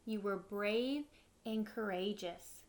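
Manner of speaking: ordinary delivery, nothing marked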